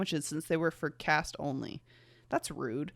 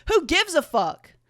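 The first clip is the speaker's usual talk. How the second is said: higher, louder and slow brash line; brash quip; 10 semitones higher, 8 dB louder than usual. Acted. Character shrugging off the question brashly. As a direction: toss it off boldly.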